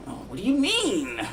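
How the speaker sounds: high, incredulous imitation; mock puzzled. People puzzled by his sceptic question, high mock disbelief.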